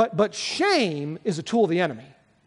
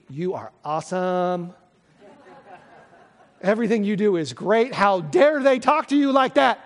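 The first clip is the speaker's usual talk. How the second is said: inner voice flattering; 2 semitones higher, 3 dB louder than usual. Retold or imitated. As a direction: puff yourself up, then get offended on your own behalf. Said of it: "sometimes mine says to me".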